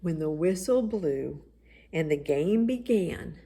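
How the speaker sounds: plain reading voice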